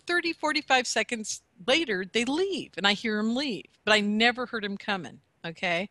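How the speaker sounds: ordinary delivery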